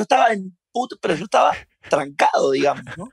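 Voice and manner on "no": usual speaking voice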